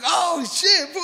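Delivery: high, loud mock shock; retold startled yelp. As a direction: act out a sudden fright, half laughing. Retells his startled reaction.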